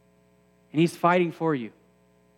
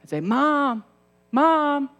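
high, loud calls of Mom; a kid yelling up to the basement stairs for mom; shouting for mom; 10 semitones higher, 6 dB louder, much slower than usual. Retold or imitated. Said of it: "And I'd shout".

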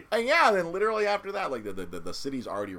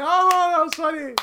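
mocking crowd; 16 dB louder, slower than usual.

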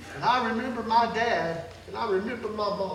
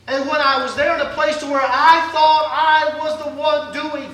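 louder preaching with a rising tune; 3 semitones higher, 7 dB louder than usual. Acted. Preacher rousing the congregation, preaching.

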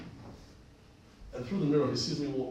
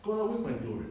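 pitched up, fervent God awake my glory; fervent prayer; 9 semitones higher than usual. Retold or imitated. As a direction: cry out the prayer higher. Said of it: "as I prayed".

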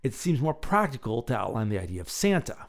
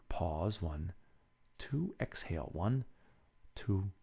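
much softer, slow counting meditation voice; hushed breathing-exercise counting; guided breathing; 4 semitones lower, 8 dB quieter than usual. Acted. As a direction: guide them through the breath count gently.

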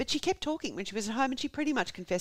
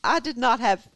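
emphatic, louder Southern denial; American Southern accent; 6 semitones higher, 6 dB louder than usual. Acted. Wife getting defensive with police, emphatic American Southern accent. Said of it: "tired of doing the accent".

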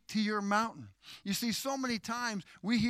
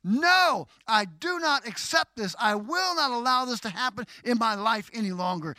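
loud, high NO, then forceful refusal; firm authority; 4 semitones higher, 6 dB louder than usual. Shown as a demonstration. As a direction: say it like you mean it. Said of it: "say it like you mean it with authority".

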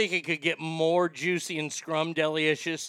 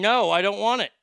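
loud frustrated no; annoyed shout; 5 semitones higher, 9 dB louder than usual. Real, not acted. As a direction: snap back at the machine. Shouting at phone menu.

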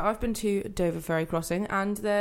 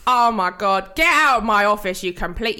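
much louder and higher, hammed-up rage; mock furious yell; 4 semitones higher, 10 dB louder than usual. Clerk screaming at a customer who can't speak, hammed up.

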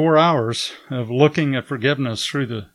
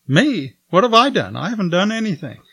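higher, startled protest, rushing at the end; retold surprise; 6 semitones higher than usual. Preacher protesting his innocence to the host, surprised. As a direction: caught off guard, defending himself.

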